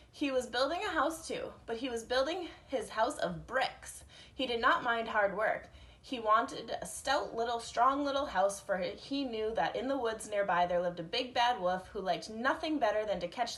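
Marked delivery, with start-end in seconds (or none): none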